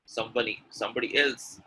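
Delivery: ordinary delivery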